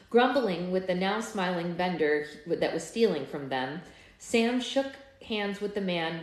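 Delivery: ordinary delivery, nothing marked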